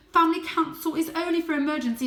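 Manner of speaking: high, loud, fast objection; father cat arguing against the council; protesting. Acted. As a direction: argue back in a rush. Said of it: "protested Father".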